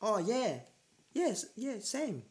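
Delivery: high, squeaky awkward agreement; a high, flustered "yes, same" teasing her awkwardness; mock awkward reply. Mocking partner's awkward reply.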